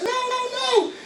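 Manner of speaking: high, thin refusal; a woman too scared to let go; frightened lady. Retold or imitated. Lady refusing from burning building.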